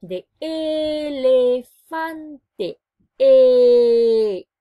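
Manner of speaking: slow "elefante", then a loud shouted E; stretched then shouted. Shown as a demonstration. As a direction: draw out the word, then shout the E. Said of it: "estamos conociendo la vocal E".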